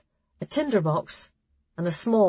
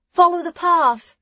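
high, louder repeated call; calling after them; 11 semitones higher, 6 dB louder than usual. Acted. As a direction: call the reminder again, louder. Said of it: "Hessa called after them".

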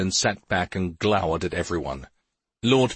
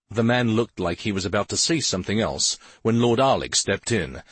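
ordinary delivery

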